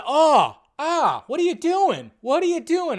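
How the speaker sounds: high, loud horrified cry; horrified outcry. Retold or imitated. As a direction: recoil in shock, voice jumping up. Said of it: "I was going".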